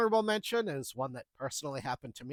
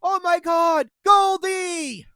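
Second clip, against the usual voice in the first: much higher, much louder horrified shout; panicked yell; 13 semitones higher, 14 dB louder, much slower than usual. Retold or imitated. Customer realizing her hamster drowned, yelling in horror. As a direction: scream the pet's name in sudden horror. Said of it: "yelled out".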